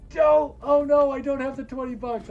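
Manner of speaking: high loud panicked squeal; panicked viewer. Retold or imitated. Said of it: "And he's like".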